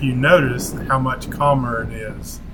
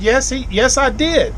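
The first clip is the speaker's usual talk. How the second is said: pitched way up, insisting yes; insistent retort; 11 semitones higher than usual. Retold or imitated. Arguing back at the dockmaster, pitched-up indignant insistence. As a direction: retort indignantly, voice jumping high. Heated retort in retold dock argument.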